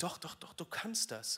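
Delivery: a touch of patient disappointment; coaxing encouragement. Acted. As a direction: gently insist he can do it. God encouraging Moses.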